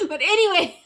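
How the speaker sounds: giggling through the words; but anyway between laughs; real laughter. Real, not acted.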